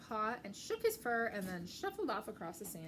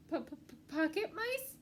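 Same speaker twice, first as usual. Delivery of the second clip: slow, stammered frightened question; stuttering fear; 7 semitones higher, much slower than usual. Acted. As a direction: trip over the p.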